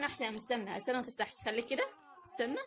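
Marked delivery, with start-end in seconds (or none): none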